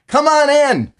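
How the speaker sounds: high, loud holler to a group outside; big retold yell of invitation; calling out. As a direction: shout them in from the doorway. Calling interns waiting outside.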